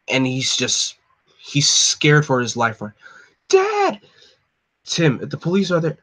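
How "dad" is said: high frantic cry for his father; panicked shout. Acted. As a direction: call out to Dad in terror. Tim runs home scared.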